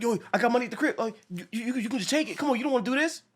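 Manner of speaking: high, pleading whine; pleading villain. Retold or imitated. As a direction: beg for your life in a high voice. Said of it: "he's like".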